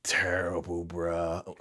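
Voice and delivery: slow, drawn-out disapproval; slow, heavy disgust; dismayed verdict. Retold or imitated. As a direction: drag it out, disgusted. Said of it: "I was like".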